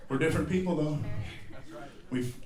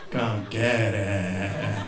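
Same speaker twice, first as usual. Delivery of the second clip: lower, very slow doom voice; slow ominous growl; 6 semitones lower, much slower than usual. Pretend. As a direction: draw it out like a horror trailer. Slow low repeat.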